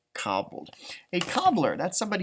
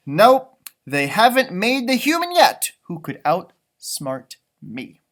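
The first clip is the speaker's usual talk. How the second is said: cocky leprechaun, louder and proud; boastful leprechaun; 6 dB louder, slower than usual. Acted. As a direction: brag like a clever little trickster. Reads boastful leprechaun lines.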